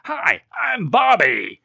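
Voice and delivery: put-on high, loud, slow kid voice; mock kid greeting. Retelling the film, boy introduces himself high and slow. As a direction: stretch it out like a goofy boy. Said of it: "he turns around and goes".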